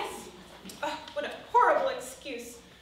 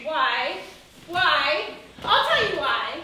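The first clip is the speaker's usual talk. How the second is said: indignant; 2 semitones higher, 7 dB louder, much slower than usual. Acted. Indignant outburst at her cousin.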